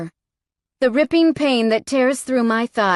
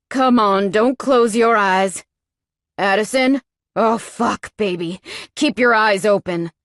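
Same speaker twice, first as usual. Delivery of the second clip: louder, desperate urging; urgent pleading; 4 dB louder than usual. Acted. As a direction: keep her awake at all costs. Lover begs her to stay awake.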